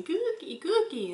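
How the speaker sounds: put-on high, loud toddler demand for a cookie; toddler yelling. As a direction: yell cookie like an impatient little girl. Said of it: "she starts yelling".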